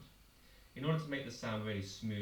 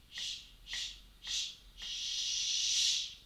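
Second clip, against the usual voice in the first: sh crescendo; 4 dB quieter, much slower than usual. Shown as a demonstration. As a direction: breathe out sh, building over the beats. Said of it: "let's do that together".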